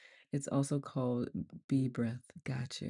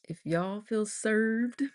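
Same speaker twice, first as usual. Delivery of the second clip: slow, higher country drawl; playfully drawn-out country accent; 6 semitones higher, 4 dB louder, much slower than usual. Pretend. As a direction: lean into a thick country twang. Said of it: "I'm not gonna do a thick country accent".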